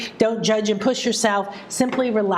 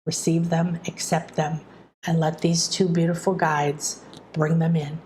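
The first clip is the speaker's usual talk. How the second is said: guided meditation; 3 semitones lower than usual. Acted. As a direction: guide them gently into calm. Leads a guided manifesting meditation.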